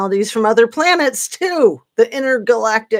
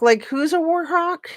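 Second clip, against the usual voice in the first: incredulous; 10 semitones higher than usual. Real, not acted. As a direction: pitch jumps way up, disbelieving question. Repeating the accusation, then mocking it.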